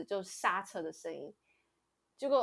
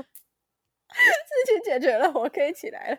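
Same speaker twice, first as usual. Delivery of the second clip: high, loud, slowly drawn out; sly relief; 9 semitones higher, 11 dB louder, slower than usual. Retold or imitated. Retelling getting up once it was sorted, slow, high and sly. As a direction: stretch it out with sneaky relief. Faking injury, then relief.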